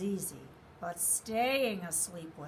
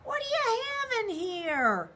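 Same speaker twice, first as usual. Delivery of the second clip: alarmed bunny; 13 semitones higher, 5 dB louder than usual. A narrator's reading. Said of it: "bunny pricked by quills".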